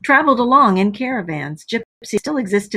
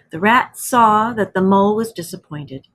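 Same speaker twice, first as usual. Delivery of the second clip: ordinary delivery, nothing marked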